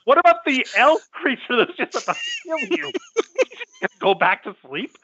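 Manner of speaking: high exasperated question; mock disbelief at the kid; incredulous. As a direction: shout it at the screen. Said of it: "I'm like".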